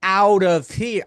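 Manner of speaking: loud, desperate plea, slowing at the end; inner scream. Retold or imitated. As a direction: yell it like your insides are screaming to leave. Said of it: "the inside of my body is screaming".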